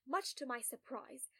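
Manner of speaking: ordinary delivery, nothing marked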